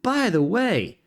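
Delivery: high-pitched, chatty character voice; perky game character. Acted. Errand-runner explaining a rock slide to Goro, high chatty voice.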